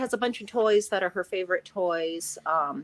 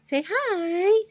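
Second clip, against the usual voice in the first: high coaxing pet talk; 10 semitones higher, slower than usual. Acted. Owner coaxes her cat to greet the viewer, high pet voice. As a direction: coax the cat up high, sing-song.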